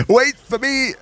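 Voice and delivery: high-pitched call to wait up; playful calling out. Pretend. Gamer calls after the others to wait for them, high playful call.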